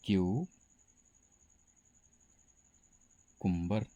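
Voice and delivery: क्यू held apart, long pause, then कुम्बर; syllable-by-syllable model, KYOO for क्यू, then कुम्बर; correct pronunciation, broken up. Shown as a demonstration. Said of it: "बल्कि इसका सही उच्चारण". (क्यू और कुम्बर को अलग-अलग करके सही उच्चारण)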